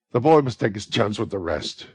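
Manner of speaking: quick, loud cut-in; hasty interruption. A narrator's reading. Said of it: "interrupted Nancy hastily".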